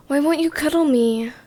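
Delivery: playfully pleading to be cuddled; playful whine. A narrator's reading. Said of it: "you whined in a playful voice".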